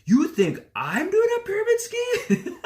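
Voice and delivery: hammed-up high, loud indignant outburst; fired up. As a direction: re-enact a slow, incredulous yell with a laugh. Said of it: "I would just get fired up".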